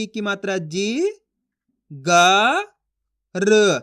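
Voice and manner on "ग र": ग and र said apart, louder and slower; letter by letter. Shown as a demonstration. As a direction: sound out ग, then र, slowly. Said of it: "एक एक अक्षर का उच्चारण करके पढ़ रहा हूँ".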